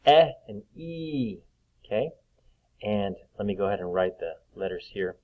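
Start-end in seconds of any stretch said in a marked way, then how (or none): 0.1-1.4 short "Eh", then long high "E". Shown as a demonstration. Teacher showing E has two sounds, slow contrast. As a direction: contrast "Eh" with a drawn-out "E" like the letter name. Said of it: "just like you're saying the letter E".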